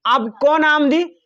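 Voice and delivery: usual speaking voice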